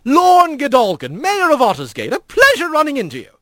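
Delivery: high, hearty self-introduction; lilting, pompous skipper voice; jovial otter mayor. Acted. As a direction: greet him like a genial dignitary.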